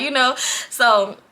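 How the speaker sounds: ordinary talk, nothing marked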